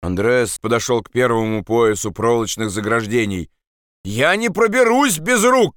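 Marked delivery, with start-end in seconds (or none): 4.1-5.7 high-pitched cry of protest; shouting. Acted. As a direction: call up to them in protest. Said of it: "крикнул он". (крик протеста)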